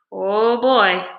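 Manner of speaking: ordinary delivery, nothing marked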